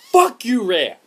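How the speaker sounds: loud, high angry shout at the developer; angry outburst. Real, not acted. Fed-up gamer cursing the developer, angry shout. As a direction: explode at them in anger.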